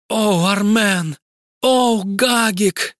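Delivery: high, slow drawn-out moans; passionate moaning. Acted. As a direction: moan the names passionately. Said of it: "страстно кричала в ночи".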